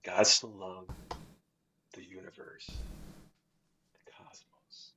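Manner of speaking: soft, fading into a whisper; hushed awe. Real, not acted. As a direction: sink to a reverent whisper. Marvels at the verse's meaning.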